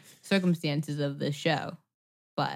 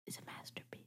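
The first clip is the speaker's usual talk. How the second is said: drops to a reverent hush for is a masterpiece; almost a whisper, full of awe; 27 dB quieter, faster than usual. Real, not acted. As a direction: breathe it out in awe. Hushed praise of a film.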